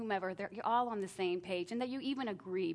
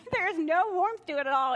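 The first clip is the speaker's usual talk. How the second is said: laughing; 9 semitones higher, 11 dB louder than usual. Real, not acted. Laughs at family drill.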